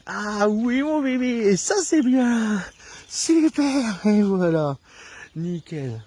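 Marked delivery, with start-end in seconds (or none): 0.1-4.8 much higher, louder, drawn-out praise. Shown as a demonstration. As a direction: reward the puppy with a high happy voice. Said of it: "donc une voix aiguë".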